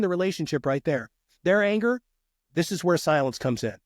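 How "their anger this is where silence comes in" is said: ordinary talk, nothing marked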